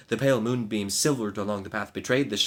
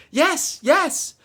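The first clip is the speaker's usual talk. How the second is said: frantic high yes; 9 dB louder than usual. Acted. Maid agrees in panic.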